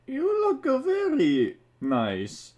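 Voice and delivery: high, slow goofy Mario; mock Mario voice. Reader voicing Mario complimenting Peach, high and slow. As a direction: drag it out like a dazed hero. Mario's line in a read fanfic.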